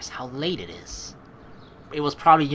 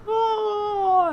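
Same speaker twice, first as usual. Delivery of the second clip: a big, high, hammed-up yawn; mock yawn; 5 dB louder than usual. Showing the chat the sneaky arm move, with a hammed-up yawn. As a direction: stretch out the oh like a fake yawn. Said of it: "just, like, yawning".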